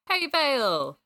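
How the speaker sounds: high, loud happy hello; glad greeting. Acted. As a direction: call out to a friend. Friend greets narrator at hospital.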